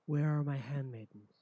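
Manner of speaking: loud rasping demand; raspy voice. Acted. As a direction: call out with a rasp. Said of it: "raspy voice".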